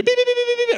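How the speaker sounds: high-pitched rapid beeping; shrill-pitched run of alarm beeps; imitated scanner beeps. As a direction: mimic the metal detector going off. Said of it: "of course, it always beeps".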